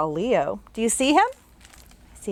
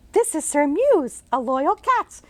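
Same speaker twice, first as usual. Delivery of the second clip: put-on much higher girl's voice; bright little girl; 7 semitones higher than usual. Jane greeting the ghost she can see, as a bright girl. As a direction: chirp it like a curious girl.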